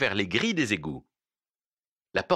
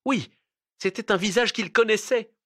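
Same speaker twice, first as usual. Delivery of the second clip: much higher, intense cry of recognition; horrified realisation; 12 semitones higher, 4 dB louder than usual. A narrator's reading. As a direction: raise pitch sharply as the shock hits. Same words repeated as horrified realisation.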